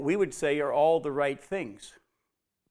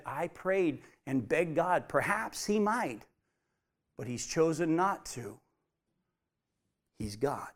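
softly acted, subdued acceptance; quiet acceptance; 3 semitones lower, 7 dB quieter than usual. David accepts God's answer after begging, quietly resigned. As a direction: say it low and soft, yielding to God.

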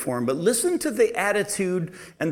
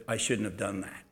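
softer, lower quiet regret; a lightly voiced humble Paul, softer and lower; 8 semitones lower, 11 dB quieter than usual. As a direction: admit it quietly. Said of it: "Paul said".

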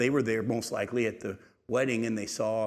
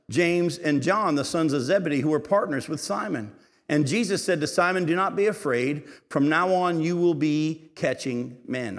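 higher, louder proclaiming Bible reading; scripture reading; 4 semitones higher, 4 dB louder than usual. Acted. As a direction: proclaim the passage to the whole church.

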